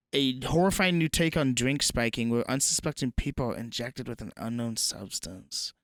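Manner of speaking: ordinary delivery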